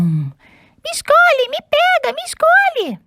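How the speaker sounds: very high, tiny begging voices; squeaky pleading socks. Acted. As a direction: squeal like eager little socks.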